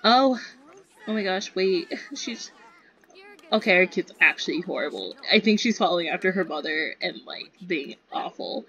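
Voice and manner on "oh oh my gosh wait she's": normal talking voice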